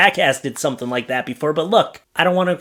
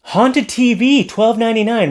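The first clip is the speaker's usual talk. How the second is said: sign announcer; 8 semitones higher, 4 dB louder than usual. Shown as a demonstration. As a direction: sing out the offer, high and loud. Reads a sale sign aloud.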